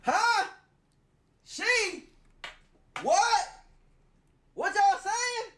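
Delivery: high incredulous squawk; stunned, can't believe it; shocked disbelief. Real, not acted. Shocked at they pronoun.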